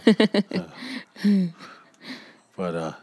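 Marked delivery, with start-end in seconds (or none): 0.1-1.5 laughing. Real, not acted. Laughing after teasing about yawning.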